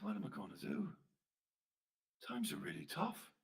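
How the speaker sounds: soft, helpless lament; forlorn. Acted. Poor Flanagan wonders how he will manage, softly forlorn. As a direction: sound at a loss, barely voicing it.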